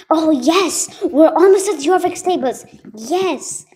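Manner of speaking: loud, high cheer of joy; excited shout. Real, not acted. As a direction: shout it out in delight. Reaching the stables in game.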